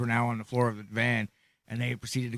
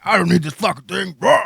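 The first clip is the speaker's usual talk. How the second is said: loud, higher-pitched dismissive shout; acted outburst; 7 semitones higher, 11 dB louder than usual. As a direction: throw it out loud and high. Acted outburst about ditching the wheelchair.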